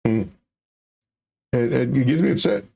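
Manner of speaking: usual speaking voice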